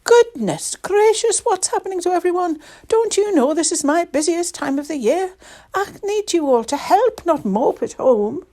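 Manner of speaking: high, loud exasperated outburst; flustered scolding at high pitch; crying out. A narrator's reading. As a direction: exclaim as an overworked Mrs Claus. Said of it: "Missus Claus cried".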